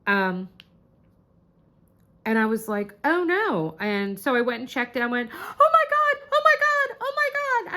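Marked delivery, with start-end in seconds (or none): 3.0-3.8 high, swooping oh no; mildly dramatic dismay, pitch jumping up. Retold or imitated. Reacts to the message. 5.3-7.8 very high squealed outburst; shrieky imitation of a fan screaming; excited screaming. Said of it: "I started screaming".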